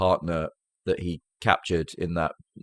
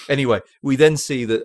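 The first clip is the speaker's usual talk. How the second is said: normal talking voice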